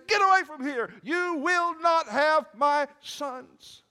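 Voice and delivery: very high, full-voiced defiant shout; fierce shout. Rizpah guarding her sons' corpses from animals, fierce shout. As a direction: shout the animals off, stretching the last words. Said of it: "she shouts at those animals".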